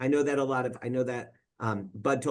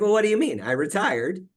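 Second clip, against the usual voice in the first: mock surprised dad; 6 semitones higher, 6 dB louder than usual. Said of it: "And he's like".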